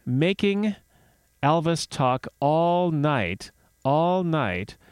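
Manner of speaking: slow, stretched-out words; drawn-out emphasis. Acted. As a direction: draw it out as if it never ends. Dramatizing an endless night of questions.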